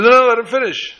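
high, loud call to let him go on; urgent interruption. Retold or imitated. Insistent interruption in retold scene.

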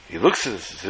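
ordinary delivery

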